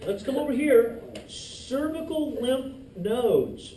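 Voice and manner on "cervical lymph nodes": slow emphasis. Shown as a demonstration. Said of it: "teacher repeats term".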